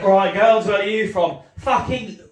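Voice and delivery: put-on loud, high drunken lad calling out; rowdy shout. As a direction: yell it across the club at the girls. Lads shouting to Birmingham girls.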